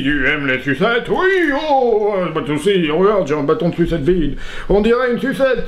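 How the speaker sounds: hammed-up magician patter with oohs; put-on, irritating show voice and accent; irritating performer's routine voice. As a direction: play an annoying children's entertainer. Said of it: "mon accent, ma voix, le tour, tout est irritant".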